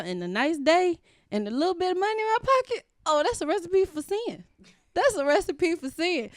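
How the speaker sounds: high, loud playful emphasis; animated warning. Real, not acted. Warning friend about idle temptation, high and loud. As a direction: sell the danger with a grin.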